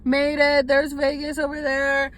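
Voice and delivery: high, loud squeals of relief; real overjoyed shouting. Real, not acted. Telling viewers she finally reached Las Vegas, elated shouting. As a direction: shout it out, voice soaring with excitement.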